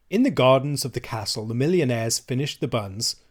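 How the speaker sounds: usual speaking voice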